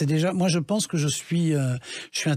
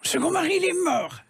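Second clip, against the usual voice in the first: much higher, dramatic lady voice; Maria Pacôme impression. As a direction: sing it out like a grand actress. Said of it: "comme elle, quand elle disait". (imitation de Maria Pacôme, très aiguë)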